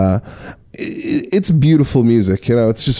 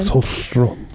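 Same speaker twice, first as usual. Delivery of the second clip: softer name in a light Swedish accent; little Swedish accent; 5 dB quieter than usual. Shown as a demonstration. Repeating the praised band name, softly Swedish-accented. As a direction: give the name a gentle Swedish sound. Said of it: "that was like a little Swedish accent".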